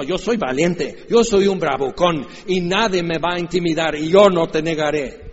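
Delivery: swaggering, loud bragging vow; boastful Peter. Retold or imitated. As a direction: brag like a fearless tough guy.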